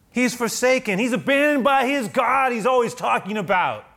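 high, loud accusing shout; taunting to the face. Acted. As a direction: shout the accusation right at them. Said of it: "sometimes to my face".

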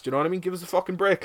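ordinary talk, nothing marked

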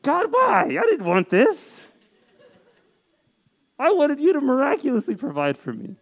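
loud, high, frustrated pleading; frustrated cry to God. Retold or imitated. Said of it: "frustrated and ungrateful".

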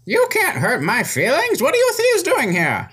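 put-on high indignant old man; old wizard voice. Wizard answers the party's insult, high indignant old man. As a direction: squeak back at the insult.

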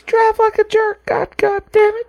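high-pitched mock baby cursing; baby's voice. Baby scolding a bad driver, high mock voice. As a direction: curse in a put-on high baby voice. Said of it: "There's a baby that's just like".

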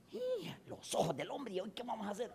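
high, hushed gasp of alarm; mock panic. Wife fearing husband lost his eyes, mock panic. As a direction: imitate a wife panicking under the breath.

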